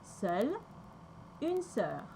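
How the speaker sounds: "seule, une sœur" with open rounded vowel; teacher modelling "seule, une sœur" for learners; French pronunciation demo. Shown as a demonstration.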